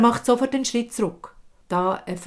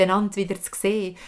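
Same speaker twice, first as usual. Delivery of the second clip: ordinary delivery, nothing marked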